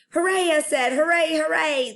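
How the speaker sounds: high, loud happy cheering; excited cheer. Acted. Junie B cheering to the principal, high and loud. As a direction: cheer it out like a thrilled kid.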